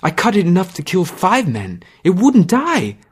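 shaken. Acted. Boy shaken after ghoul fight.

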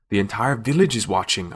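plain reading voice

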